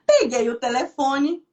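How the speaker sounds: a sob breaks in, slow tearful words; real crying. Real, not acted. Pastor tearing up recalling calling her sister. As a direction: fight back tears as you speak.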